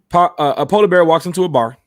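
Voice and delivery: proud dad-joke opener; joke setup. Acted. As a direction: announce the punchline-to-be with gusto.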